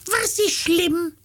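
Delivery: very high, slow falsetto; squeaky slow riddle in monkey falsetto; Äffle cartoon monkey. New Äffle version for Hansi, high monkey voice. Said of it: "Der geht jetzt folgendermaßen".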